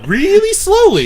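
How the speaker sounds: high, stretched-out "really slowly"; mock-patronizing, elongated words; drawn-out slowness. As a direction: drag out "really slowly" mockingly. Mimicking being talked down to slowly.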